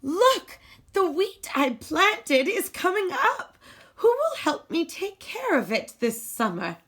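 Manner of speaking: the little hen eagerly showing off her wheat; excited hen. Acted. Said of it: "the little red hen cried".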